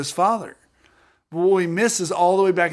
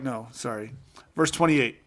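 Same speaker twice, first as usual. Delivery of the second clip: usual speaking voice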